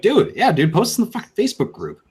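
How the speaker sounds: high, loud urging; hyped-up friend urging; excited. Retold or imitated. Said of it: "and I'm like".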